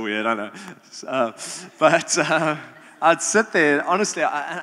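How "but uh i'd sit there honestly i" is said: loud laughing through the words. Real, not acted. Youth leader laughing at his own weird habit. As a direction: laugh it off, embarrassed.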